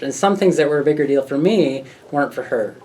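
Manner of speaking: ordinary talk, nothing marked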